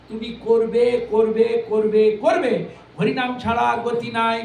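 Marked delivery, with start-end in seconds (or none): 0.1-2.5 করবে repeated louder each time; building emphasis. Acted. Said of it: "সম্পূর্ণ জোর দিয়েছে". (ক্রমশ জোরে করবে)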